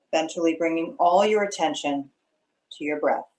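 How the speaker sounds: unhurried meditation guide with long pauses; guided meditation. Acted. Meditation leader settling students into their breath, calm guiding voice. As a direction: ease them into stillness, phrase by phrase.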